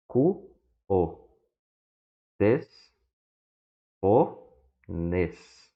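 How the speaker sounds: syllable by syllable, each one set apart; read separately. Shown as a demonstration. Teacher reading Greek words to students syllable by syllable. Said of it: "Mas lemos aqui, ó, os termos aqui separadamente".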